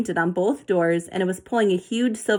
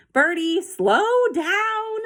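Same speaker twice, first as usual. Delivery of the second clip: anxious hiss; 10 semitones higher, much slower than usual. A narrator's reading. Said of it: "Zoe hissed anxiously".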